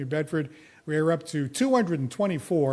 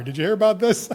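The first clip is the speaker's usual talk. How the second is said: raised, louder question tipping into laughter; amused, laughing question; 4 semitones higher, 6 dB louder than usual. Real, not acted. Host teasing guests about a retirement report, amused and breaking up. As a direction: ask it on the verge of cracking up.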